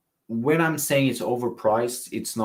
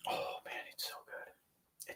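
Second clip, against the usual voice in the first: awed whisper; 20 dB quieter than usual. Real, not acted. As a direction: breathe it out, savouring. Savouring the perfume, whispers.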